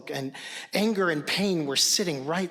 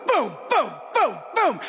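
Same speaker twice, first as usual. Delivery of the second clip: booming stomps; 9 semitones higher, 6 dB louder than usual. Retold or imitated. Father stomping on stairs.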